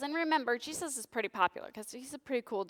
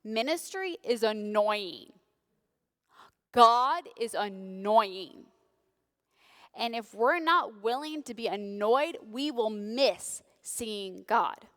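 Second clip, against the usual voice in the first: slow, weighty, louder on God; preaching emphasis; 4 dB louder, slower than usual. Real, not acted. Pastor drives home the point, slow and firm. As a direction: let each word land slowly.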